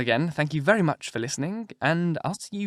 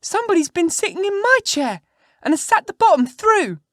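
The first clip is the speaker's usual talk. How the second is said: put-on very high, loud squeaky baby-bear voice; little wee bear; 8 dB louder than usual. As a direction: squeal it out as a tiny, outraged bear. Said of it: "in his little wee voice".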